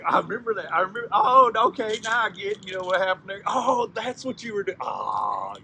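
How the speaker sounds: high, loud delighted realisation; merry recollection. Acted. Man and rabbit swapping versions of the story, high excited exclaiming. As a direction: exclaim as the whole story suddenly clicks. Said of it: "they're laughing and having".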